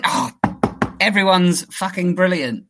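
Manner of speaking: usual speaking voice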